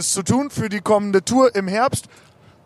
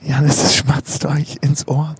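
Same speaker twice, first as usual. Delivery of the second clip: whispering; 5 semitones lower than usual. Acted. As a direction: hush it close like ASMR. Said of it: "diese leicht erotische Stimmung".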